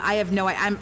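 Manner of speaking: ordinary talk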